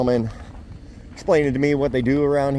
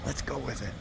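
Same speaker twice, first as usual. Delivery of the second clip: soft hushed whisper at the fish pen; 12 dB quieter than usual. Real, not acted. Whispering beside the pen while fish are fed. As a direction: keep it to a whisper so the fish stay calm. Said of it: "I don't know why I'm whispering".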